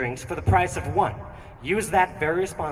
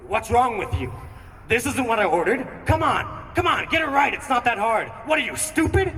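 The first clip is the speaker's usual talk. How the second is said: higher, mock irate rant at the cashier; angry customer yelling; 6 semitones higher than usual. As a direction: play the rude customer snapping at the barista. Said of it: "he is not happy with me".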